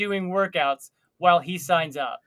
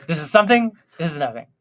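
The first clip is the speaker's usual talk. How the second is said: usual speaking voice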